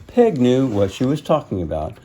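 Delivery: usual speaking voice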